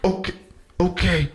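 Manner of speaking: high, slow, weak dying plea; dying man. Retold or imitated. Man shot at strip club begs the cop, high slow plea. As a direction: beg slowly, fading away. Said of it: "I'd be like".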